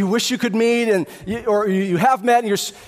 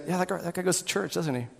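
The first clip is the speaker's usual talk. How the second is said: low, hushed aside about someone; softly voiced bystanders; onlookers' remarks; 4 semitones lower, 9 dB quieter than usual. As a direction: murmur it like people talking behind a back. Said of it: "When people look at you and say".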